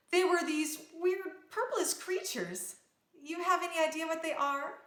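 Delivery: put-on a touch of anxious young princess; lightly voiced frightened girl. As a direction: describe the thieves with a hint of alarm.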